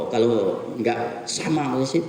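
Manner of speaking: ordinary talk, nothing marked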